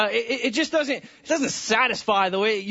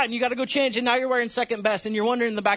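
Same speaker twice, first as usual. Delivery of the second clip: ordinary talk, nothing marked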